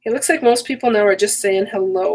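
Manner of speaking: ordinary talk, nothing marked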